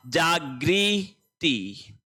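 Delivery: जागृति very slowly, jaa - gree - ti; syllable-by-syllable model. Shown as a demonstration. Teacher stressing that ri comes here, जागृति in slow syllables. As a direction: stretch जागृति out with a long ri. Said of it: "यानी री ही आएगा".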